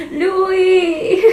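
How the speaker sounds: high strained cry of a name; croaked cry. A narrator's reading. Hannah croaks a name at the bloody face, high and strained. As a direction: rasp the name out in terror. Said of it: "krächze ich".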